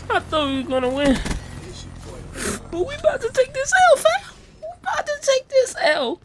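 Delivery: high, fast mock crying whine; squeaky hammed-up wail; mock despair. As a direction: whimper like the end has come. Despairing quote about losing.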